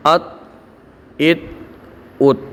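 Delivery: each syllable "अत" ends in a bouncing echoed stop; slow, separate syllables with a rebound on the final consonant; qalqala drill. Shown as a demonstration. Quran teacher drilling students, bouncing echoed qalqala syllables. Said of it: "कलकला के अल्फाज हैं, उन्हीं के अंदर आवाज़ पलट के आएगी".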